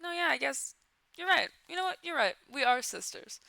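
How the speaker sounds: high-pitched, eager mock agreement; mock ant agreeing. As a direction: play a character being won over, pitched well up. Voices the ants suddenly agreeing.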